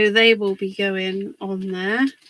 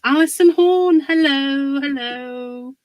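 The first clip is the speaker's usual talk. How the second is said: higher and louder welcome; bright hello; 4 semitones higher, 6 dB louder than usual. Real, not acted. Greeting a viewer.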